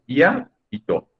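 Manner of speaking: slow, high syllable count on "ya y to"; two separate syllables. Shown as a demonstration. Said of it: "tiene dos sílabas".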